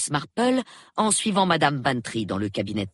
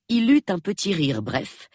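plain reading voice

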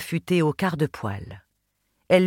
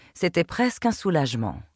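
plain reading voice

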